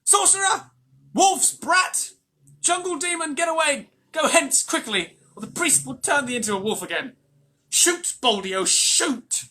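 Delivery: high, loud angry mob yells; furious crowd shouting at the boy; villagers shouting. A narrator's reading. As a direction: scream them out of the village. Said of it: "and the villagers shouted".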